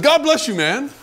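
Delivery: high, loud, exasperated blessing; acted-out indignant call after someone; upset blessing. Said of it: "I was upset now. I said".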